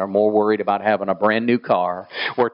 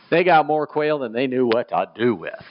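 ordinary talk, nothing marked

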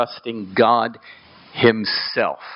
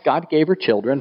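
normal talking voice

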